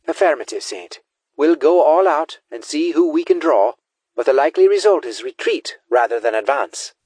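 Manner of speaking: lightly voiced marine giving a battle assessment; marine on comms. Marine answers Saint on the plan for a distraction, brisk report.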